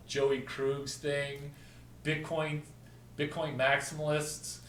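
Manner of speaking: ordinary delivery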